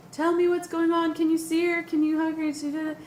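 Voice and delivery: high, loud anxious pleas; desperate pleading. Retold or imitated. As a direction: beg for news of the baby.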